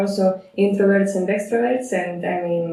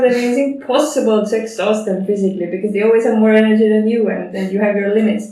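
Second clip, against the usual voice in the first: usual speaking voice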